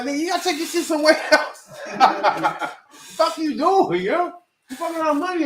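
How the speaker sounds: laughing mock scold. Hustlers shooing the rapper away, laughing. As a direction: laugh through the brush-off.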